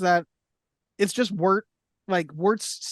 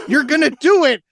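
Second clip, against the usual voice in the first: louder, higher cheer; cheering shout; 7 semitones higher, 8 dB louder than usual. Retold or imitated. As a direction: yell encouragement from ringside. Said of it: "Adrian in the background going".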